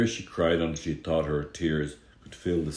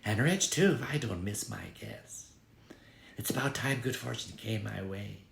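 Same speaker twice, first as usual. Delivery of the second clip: put-on higher, greedy young woman, dropping soft; higher and softer, a gold-digger's aside; scheming girl; 6 semitones higher, 6 dB quieter than usual. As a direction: let the greed slip out under your breath. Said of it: "the girl said softly".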